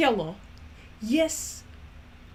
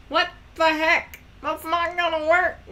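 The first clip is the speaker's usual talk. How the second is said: louder and higher in frustration; frustrated outburst; 7 semitones higher, 8 dB louder than usual. Real, not acted. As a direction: blurt it out, fed up. Accent changing, frustrated.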